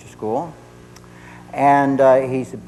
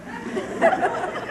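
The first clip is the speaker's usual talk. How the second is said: short high mock snarl; imitated stinger back; 5 dB quieter than usual. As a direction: fire back a wordless jab. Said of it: "so I shot one back".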